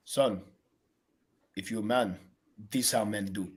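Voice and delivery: low, quiet mock-macho voice; lightly voiced Turbo lecturing his son; a hint of Turbo laying down the law to his kid, low and soft; Turbo impression. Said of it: "Turbo's teachable moments would go a little something like this".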